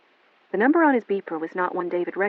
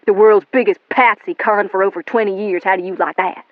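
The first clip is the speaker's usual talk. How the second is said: building to loud jeering; cruel taunt; 7 dB louder than usual. A narrator's reading. William taunting Melanie about her family. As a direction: sneer it louder and louder.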